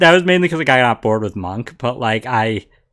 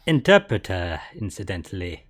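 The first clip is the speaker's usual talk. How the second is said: put-on French accent; 6 semitones lower, 8 dB quieter than usual. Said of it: "French accent that's also kinda gravelly".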